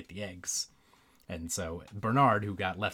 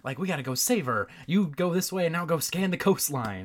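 raised, higher pitch, fretful retelling; urgent mock scolding; 7 semitones higher, 4 dB louder than usual. Bernard urging Wilbur to save Bianca, urgent mock scolding. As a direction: act out an agitated rescuer. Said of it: "Bernard's like".

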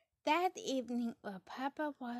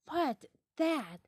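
startled question; 7 semitones higher, 10 dB louder than usual. Acted. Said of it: "she asked".

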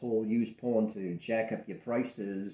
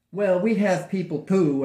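mock threat; 10 semitones higher, 12 dB louder than usual. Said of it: "the reporter's threat".

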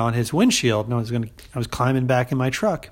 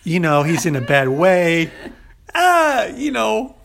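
high, loud hammed-up Italian-American voice; Italian-American tough guy; 9 semitones higher, 3 dB louder than usual. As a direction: play the big-hearted paisan looking out for his brother. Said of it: "Maybe he was like".

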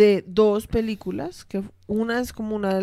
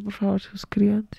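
whispering; slower than usual. Acted. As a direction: whisper it slowly, pleading. Whispered plea to subscribe.

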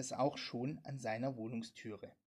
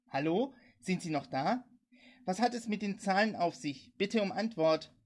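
louder, raised urgent call; pressing, projected radio call; 5 semitones higher, 6 dB louder than usual. A narrator's reading. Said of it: "rief er in sein Mikrofon".

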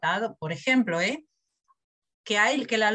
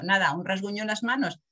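normal talking voice

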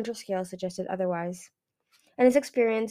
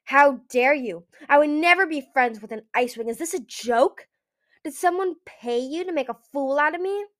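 raised, louder, indignant outrage; furious; 5 semitones higher, 6 dB louder than usual. A narrator's reading. Skywing throttles the Ice Wing, furious. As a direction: fling each question in fury. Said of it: "Eagle swelled up with fury".

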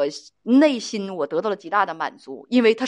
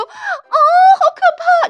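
shrill high squealing cry, much higher and louder, very slow; mock girly fright; 9 dB louder, much slower than usual. Imitating a sheltered girl spotting a cockroach, squealing.

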